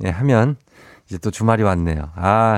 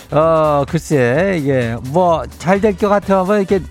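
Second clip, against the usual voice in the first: Chungcheong dialect; 6 semitones higher than usual. Acted. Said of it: "행진리 이장인데요".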